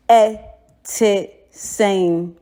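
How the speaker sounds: "Ete seng" slow, short vowels, pitch falling; short vowel going down. Shown as a demonstration. Teacher models the short falling Twi vowels. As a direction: let "Ete seng" go down, never long. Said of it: "It's short vowel".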